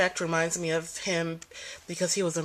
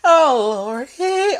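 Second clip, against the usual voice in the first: loud, high yelp of pain; 9 semitones higher, 10 dB louder, much slower than usual. Real, not acted. Speaker moving with a bad back, yelping in pain. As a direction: cry out as your back twinges. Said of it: "You may hear me yelp in pain".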